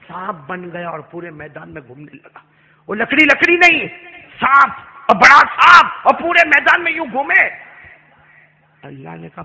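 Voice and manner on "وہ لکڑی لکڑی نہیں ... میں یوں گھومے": loud, high dramatic exclamation; thundering sermon climax; full-force preaching. Acted. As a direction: proclaim the wonder at the top of the voice.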